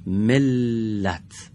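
ملت drawn out very slowly, doubled ل held; slow gemination demo. Shown as a demonstration. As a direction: stretch ملت for pupils to hear the double l. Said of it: "آفرین به شما".

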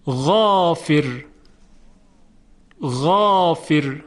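غافر slow, with a full, thick ا; heavy alif. Shown as a demonstration. Teacher showing the heavy غافر slowly. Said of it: "کلمهٔ دیگر".

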